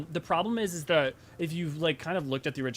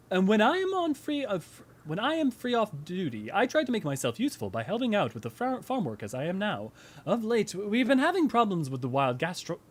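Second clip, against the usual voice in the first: high, lilting character voice; courteous camp retainer; 6 semitones higher than usual. Acted. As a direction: voice a well-mannered knight's helper, pitch swooping up.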